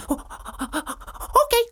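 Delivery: high, squeaky monkey giggle. Acted. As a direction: chirp it like a happy monkey. Monkey voiced in story.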